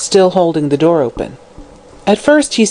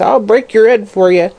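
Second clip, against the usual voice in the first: high crone threat; shrieking threat; 5 semitones higher than usual. Acted. Hag threatening the girl, high shriek. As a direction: screech it at the girl. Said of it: "she shrieked with a volley of oaths".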